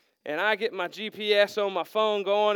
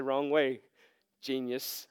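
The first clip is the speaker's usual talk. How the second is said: lower, dry, softer mock rebuke; a hint of a snarky GPS; sarcastic phone voice; 8 semitones lower, 6 dB quieter, slower than usual. The phone mocking the lost hiker, dry sarcasm.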